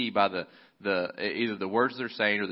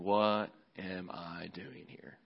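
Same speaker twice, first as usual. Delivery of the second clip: slow musing; 6 dB quieter, much slower than usual. Acted. Elijah's inner thought.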